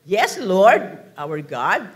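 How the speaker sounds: loud, ringing reading of the verse; declaimed scripture. Acted. Preacher reads Isaiah aloud, proclaiming loudly. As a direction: proclaim it up to the rafters.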